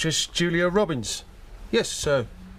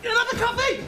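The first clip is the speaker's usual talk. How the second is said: hyper demand; 4 dB louder than usual. Acted. Caffeine-crazed customer.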